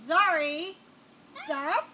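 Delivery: announcing the name. Real, not acted. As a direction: call it out like a winner. Announces the second nominee.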